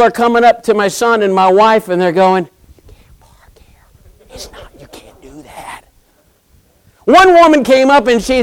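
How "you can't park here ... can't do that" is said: hushed whisper, barely voiced; mock whispered scolding; whispered complaint. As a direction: whisper it like gossip behind their backs. People complain to his family.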